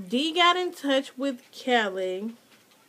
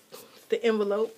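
ordinary delivery

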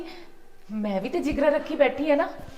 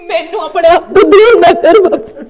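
loud sobbing plea; pleading with father-in-law, loud and crying; 4 semitones higher, 16 dB louder than usual. Acted. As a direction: beg through tears not to be sent away. Daughter-in-law begging father-in-law tearfully.

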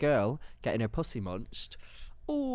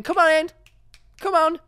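much higher, louder calling dogs in; 9 dB louder than usual. Pretend. Dog owners calling pets into the assembly, loud call.

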